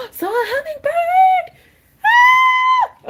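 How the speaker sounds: very high, loud squeal of excitement; excited scream. Real, not acted. Crafter spots a hummingbird mid-stream, excited scream. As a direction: shriek with delight, drawing the words out. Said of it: "Oh, you really screamed right into the mic".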